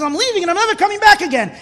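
high, loud, fast mock outburst; retold anger. Rabbi voicing a frustrated God storming out, retold anger. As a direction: blurt it as an imitated angry walkout. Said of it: "he's so angry".

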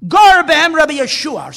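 loud, high rebuke in Aramaic; rebuke yelled. Retold or imitated. Rabbi rebuking the falling walls, shouting. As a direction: bark the rebuke at the walls, loudly. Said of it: "yelled at the walls".

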